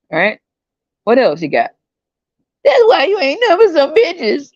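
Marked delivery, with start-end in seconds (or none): 2.6-4.5 high put-on voice of a scornful woman; mock woman throwing insults, high voice. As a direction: sneer it up high like a catty woman. Voicing women insulting him.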